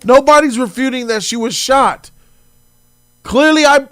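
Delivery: loud, heated comeback; loud retort. Real, not acted. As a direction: fire back at the clip. Shouting back at the clip.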